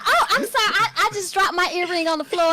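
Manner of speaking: high-pitched fake excuse; mock apology. Joking about a parking-lot earring excuse, mock apology.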